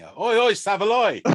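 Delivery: loud, high Cockney geezer shout; Guy Ritchie Cockney. Pretend. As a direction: belt it like a Guy Ritchie Cockney wide boy. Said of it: "proper sort of Guy Ritchie Cockney".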